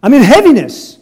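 high, loud, whiny mock protest; sarcastic imitation of a grumbling believer; mocking complaint. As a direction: throw the listener's gripe back at them, hammed up. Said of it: "You mock me".

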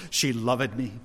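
put-on laughing through the old-fashioned loved. Shown as a demonstration. Preacher laughing at the outdated way of saying loved. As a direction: chuckle as you say it.

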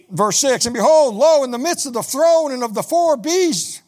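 high, loud proclaiming of the verse; preached scripture reading. Acted. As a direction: ring out Weep not and the Lion. Reads scripture aloud.

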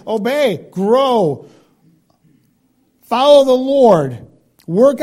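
building to a loud, high call; preacher's rising charge; rising exhortation. Real, not acted.

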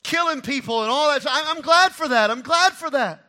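loud, high, fervent preaching; shouted sermon climax; full-force preaching. Real, not acted. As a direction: drive it home from the pulpit.

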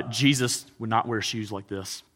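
normal talking voice

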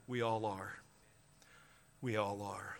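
low, hushed aside; very soft, low-pitched reflection; sober admission. Real, not acted. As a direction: drop right down and admit it softly. Sober admission closing the story.

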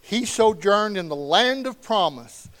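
loud, measured Bible reading; scripture reading. Acted. Pastor reading Hebrews eleven to the congregation, loud and proclaiming. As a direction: proclaim each verse to the room.

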